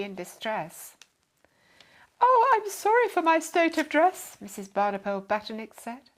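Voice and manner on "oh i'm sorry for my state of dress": high, loud, apologetic lady's voice; a fussy lady saying sorry in a rush; flustered lady apologising. A narrator's reading.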